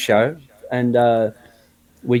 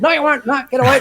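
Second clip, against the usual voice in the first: much higher, squeaky laughing refusal; mock protest; 10 semitones higher, faster than usual. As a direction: wave him off in playful disbelief. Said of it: "And I said".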